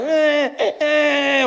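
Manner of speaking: imitating a crying baby; mock baby cry. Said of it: "Other babies are crying like".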